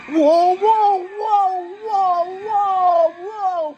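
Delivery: very high wailing cries; loud, high yelps running together; panicked whoas. Acted. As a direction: wail whoa repeatedly in panic. Everyone driving at once.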